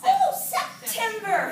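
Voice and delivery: loud, high exclamation; sudden recall. Pretend. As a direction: burst out as the answer hits you. Acts sudden recall of the month.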